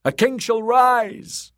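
high, drawn-out rallying shout; loud, high acclamation; crowd cry. Acted. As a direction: cry it out like a fervent mob. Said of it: "the drowned men cried".

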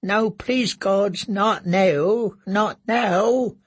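terrified pleading. Acted. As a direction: plead with the gods in terror. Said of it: "he thought".

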